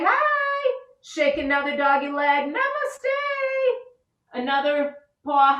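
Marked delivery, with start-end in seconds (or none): 0.0-0.8 high squeaky say hi; playful high hi. Pretend. Teacher playing puppy for kids, squeaky. 2.5-3.7 high squeaky namaste; playful high namaste. Pretend. Teacher greeting kids in a squeaky voice.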